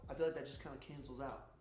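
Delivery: normal talking voice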